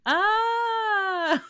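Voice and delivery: high startled mock shriek; retold scream. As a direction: yelp up high in fake shock. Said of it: "that's when I was like".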